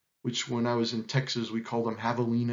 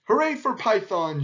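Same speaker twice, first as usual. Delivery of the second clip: higher-pitched group hooray; shouted cheer; 7 semitones higher, 4 dB louder than usual. A narrator's reading. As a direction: cheer for the hero. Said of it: "shouted everyone".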